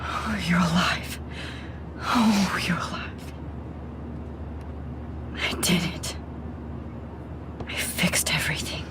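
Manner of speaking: soft, breathless whisper of relief; hardly daring to believe it, barely voiced; hushed, stunned relief; relieved whisper. Relief finding Chloe alive.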